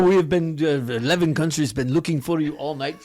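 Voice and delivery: put-on lightly done French accent, a touch stern; a hint of a stern French accent. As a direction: play a stern French official. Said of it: "stern accent too when they speak".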